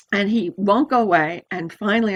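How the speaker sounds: ordinary talk, nothing marked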